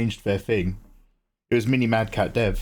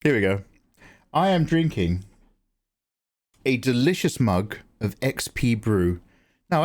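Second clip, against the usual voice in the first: ordinary talk, nothing marked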